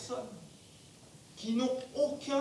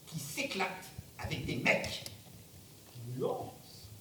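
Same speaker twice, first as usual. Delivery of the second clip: sly aside; 7 semitones lower, 6 dB quieter than usual. Acted. Roy's sly punchline after insisting.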